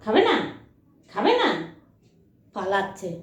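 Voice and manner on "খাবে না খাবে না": high coaxing pet talk; high pitched pleading with a pet; coaxing the dog. Real, not acted. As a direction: sweet-talk a stubborn dog into eating. Coaxing dog that refuses food.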